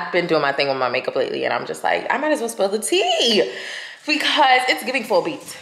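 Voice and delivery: ordinary talk, nothing marked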